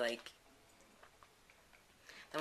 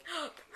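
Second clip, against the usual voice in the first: sharp gasp; 5 dB quieter than usual. Pretend. Reacts to rude joke.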